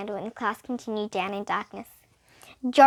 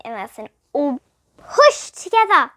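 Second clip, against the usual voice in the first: usual speaking voice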